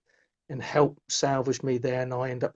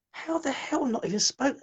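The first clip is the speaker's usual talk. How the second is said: high-pitched, incredulous question; stunned disbelief; 11 semitones higher than usual. Retold or imitated. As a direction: react in disbelief, voice jumping up. His stunned reaction to the medium.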